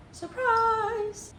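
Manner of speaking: high, bright "surprise" squeak; excited. Acted. Reveals snack with high call.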